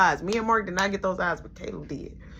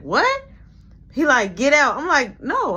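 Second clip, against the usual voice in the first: sibling spat; 6 semitones higher, 6 dB louder than usual. Retold or imitated. Argument with little brother.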